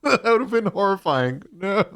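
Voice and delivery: loud shocked laughing. Real, not acted. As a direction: react in horror, laughing. Shocked reaction.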